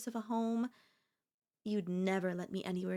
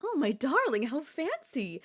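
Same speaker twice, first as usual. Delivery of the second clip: louder, higher delighted darling; delighted gush; 5 semitones higher, 5 dB louder than usual. Acted. Girlfriend thrilled by the limo outside, delighted gush. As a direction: gush over the fancy ride.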